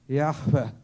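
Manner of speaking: Yahvé pushed out on a loud breath. Shown as a demonstration. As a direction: say Yahvé as a strong exhale. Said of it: "requiere un esfuerzo de respirar". (Yahvé dicho con un fuerte soplo de aire)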